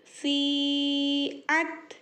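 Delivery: long held vowels, very slow; slow chanted spelling; drawn-out recitation. Shown as a demonstration. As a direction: stretch each sound like Quran reading. Teacher drawing out vowels.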